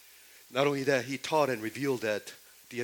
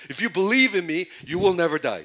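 proclaiming quote; 6 semitones higher, 10 dB louder than usual. Acted. Preacher voicing Jesus' claim.

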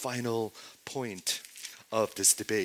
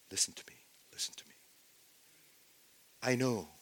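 soft whisper, gently pleading; pleading tenderly; 3 semitones lower, 6 dB quieter than usual. Acted. Jesus pleading gently with his accusers in a soft whisper. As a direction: whisper it like a plea to a loved one. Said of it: "do you feel like this is more".

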